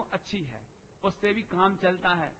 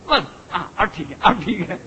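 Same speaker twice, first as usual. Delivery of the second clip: relieved; 4 semitones lower, 6 dB quieter than usual. Retold or imitated. Relief when newborn cries.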